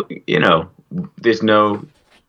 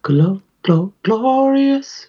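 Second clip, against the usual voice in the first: stuttered, then a much higher glorious; mock glorious; much slower than usual. Cohost mocking the word glorious, high squeal.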